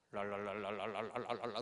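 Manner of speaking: low sing-song babble of speaking in tongues; mock tongues babbling. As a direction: rattle off nonsense syllables like praying in tongues. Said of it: "이렇게 갑자기 기도하는 거예요".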